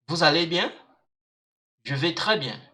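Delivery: normal talking voice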